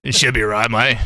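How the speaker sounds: mock Australian, lightly put on; a hint of an Australian accent. Shown as a demonstration. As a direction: say it like a laid-back Aussie. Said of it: "I think it's that one".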